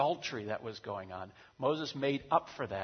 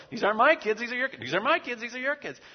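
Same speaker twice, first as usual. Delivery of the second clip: much higher and louder, bickering; parental quarrel shout; 12 semitones higher, 9 dB louder, faster than usual. Acted. God and Moses bickering over whose kids these are, shouting. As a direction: throw the kids back at each other. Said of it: "God and Moses are having this parental".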